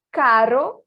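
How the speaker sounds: "Caro" with one tongue tap; "Caro", a quick flick on the R; tapped R. Shown as a demonstration. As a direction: clear demo of "Caro". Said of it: "também é igual".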